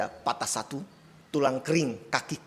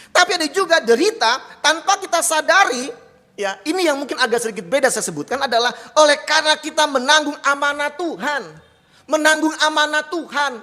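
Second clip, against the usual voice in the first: higher, louder impassioned preaching; full-force preaching; 11 semitones higher, 7 dB louder than usual. Acted. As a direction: drive the point home to the congregation. (khotbah berapi-api, lebih tinggi dan keras)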